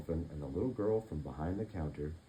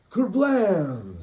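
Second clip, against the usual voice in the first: loud high blast noise; cannon boom; 11 semitones higher, 12 dB louder than usual. Acted. Narrator sounding the pirate cannon firing, loud burst. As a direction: make the cannon explode.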